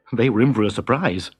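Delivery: ordinary delivery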